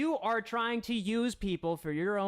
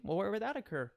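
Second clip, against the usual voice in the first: ordinary talk, nothing marked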